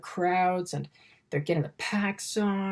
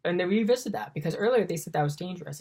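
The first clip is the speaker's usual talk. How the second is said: usual speaking voice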